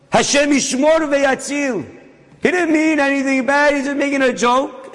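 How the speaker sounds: high, rising sermon fervour; preaching at full force. Acted. Preacher building heat over the mocking story.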